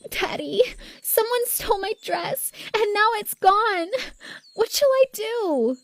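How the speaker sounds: very high little-princess voice; pleading spoiled daughter. A narrator's reading. Sister lying to win sympathy.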